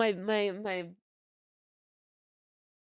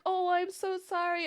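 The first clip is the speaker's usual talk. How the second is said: put-on high little-boy apology; imitating nephew; 8 semitones higher than usual. Nephew apologizing to aunt he punched, high kid voice. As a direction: squeak out a kid's sorry.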